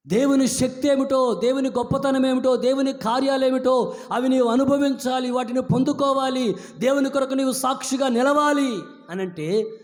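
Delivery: high, loud preaching build; fervent preaching. Acted. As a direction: drive it home to the congregation. Preaching at full force.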